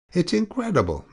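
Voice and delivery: ordinary delivery, nothing marked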